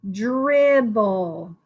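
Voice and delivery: "Dribble" slowly, both syllables stretched; sounding out. Shown as a demonstration. Teacher repeats the word for pupils, sounding out. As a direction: draw out "Dribble" as drib, then bul. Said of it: "Try sounding out the word dribble".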